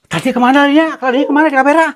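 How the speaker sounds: high, urgent searching question; alarmed. Real, not acted. She vanished from view, alarmed high questions. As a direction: pitch up in alarm, looking around.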